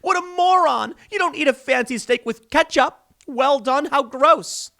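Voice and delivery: high-pitched hammed-up derision; mock disgust, pitched well up. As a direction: sneer like an appalled food snob. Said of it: "the media mocked and laughed".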